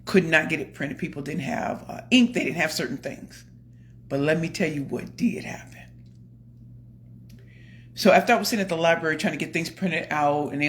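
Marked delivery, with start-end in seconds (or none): 4.1-5.7 hushed secret whisper; a conspiratorial whisper before the reveal. Real, not acted. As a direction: lean in and whisper it. Secretive lead-in to the story.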